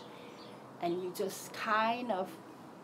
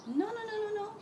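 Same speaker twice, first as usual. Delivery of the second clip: higher fake-concerned voice; lightly voiced worried mind; mocking mind; 12 semitones higher, faster than usual. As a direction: pretend to worry, teasing. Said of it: "answer my mind".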